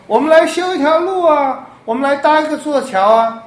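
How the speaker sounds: loud, high-pitched mock rallying cry; 高声号召. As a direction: call out as if rounding up a road crew. 假拟施洗约翰号召修路搭桥. (拉高嗓门吆喝)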